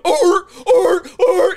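loud high barking seal. Retold or imitated. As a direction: bark it out like a seal. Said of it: "and he's just going".

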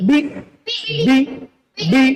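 বি cut short, no drawn vowel; without stretch or push. Shown as a demonstration. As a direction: say বি briefly, with no push. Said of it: "টান ও ধাক্কা ছাড়া পড়তে হয়". (টান ও ধাক্কা ছাড়া ছোট করে বি)

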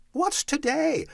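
high, eager cry; excited high-pitched hail; calling down. A narrator's reading. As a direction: call to someone below. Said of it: "calling downward to a boy".

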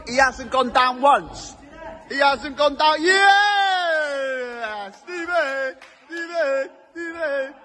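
rally him from the sideline with a high excited cheer. Real, not acted. Friend cheering Steve through the jumps, high excited shouting.

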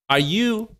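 ordinary talk, nothing marked

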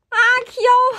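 very high and loud squeal; excited shriek. Real, not acted. Fan finding the close-up photo, shrieking. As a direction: burst out at the cuteness.

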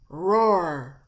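loud, drawn-out roar; big swelling animal roar; imitated lion roar. Shown as a demonstration.

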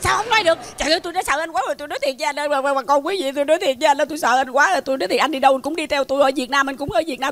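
fast, breathless comic tirade; pleading, fed-up rapid-fire rant; exasperated rant. Acted. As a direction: rattle off the complaints without a pause. Exasperated rant at persistent suitor.

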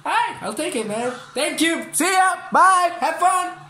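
high, loud, sing-song goodbyes; chirpy mock politeness. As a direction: play an overly sweet shop assistant. Cheerfully thanking another player.

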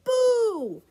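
high, loud ghostly yell; a child's big scare shout; loud boo. A narrator's reading. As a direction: jump out and scare Mommy. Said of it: "ran over to Mommy and yelled".

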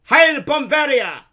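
very high, loud, drawn-out military cry; shouted salute. Acted. Officer saluting his baron, shouted. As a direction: shout it like a parade-ground salute.

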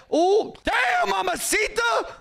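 very high hammed-up whoop; playful catcall. Shopkeeper seeing a customer, hammed-up high catcall. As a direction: holler it way up in pitch.

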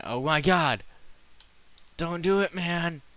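high, loud frantic pleading; panicked shouting. Real, not acted. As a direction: beg loudly in a panic.

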